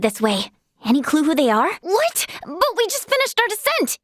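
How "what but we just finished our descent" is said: high-pitched, incredulous objection; dismayed and protesting; shocked protest. Acted. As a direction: blurt it out in disbelief, pitched way up. Protesting at being sent back.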